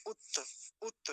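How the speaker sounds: उत्त cut short as "utt"; half consonants. Shown as a demonstration. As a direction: end उत्त on a bare t. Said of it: "दोनों तकार को आधा कर देते हैं".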